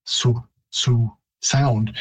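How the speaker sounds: Su drawn out slowly, the I W merged into one vowel; slow Su sound. Shown as a demonstration. Showing how the ending merges: slow careful Su. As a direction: slowly repeat Su, Su. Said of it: "merging to more of a Su".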